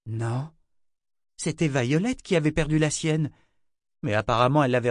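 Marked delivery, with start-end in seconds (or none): none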